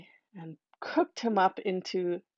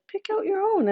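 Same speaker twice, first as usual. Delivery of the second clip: much higher, sugary coaxing stepmother; 12 semitones higher than usual. Acted. Stepmother tempting the boy toward the chest, high and sweet. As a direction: lure him in with a sweet high voice.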